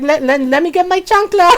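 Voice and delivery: playful menace of a mom with a sandal; threatening mom. Retold or imitated. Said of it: "She's like".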